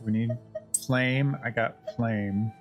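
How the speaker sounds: normal talking voice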